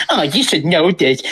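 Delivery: sneering imitation of a nagging teacher; mock her condescending tone. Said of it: "teachers telling me".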